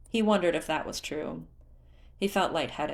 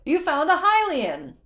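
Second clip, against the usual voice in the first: high, loud call from far above; calling down from a tower; 11 semitones higher, 8 dB louder than usual. Acted. Groove calls from the tower top, high and loud. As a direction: call down across the distance. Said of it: "Another voice called from the tower".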